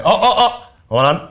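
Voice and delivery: high, loud mock excitement; mock excited recognition. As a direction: imitate someone who just caught on, squealing. Australians reacting to her accent.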